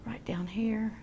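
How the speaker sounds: soft whisper to herself. Real, not acted. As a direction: breathe the words onto the canvas. Painter murmurs while working.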